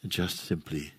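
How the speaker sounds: low, soft phrases, each left to settle; guided meditation. Real, not acted. Teacher guides listeners into resting in their own presence, guided meditation. Leads a silent guided contemplation.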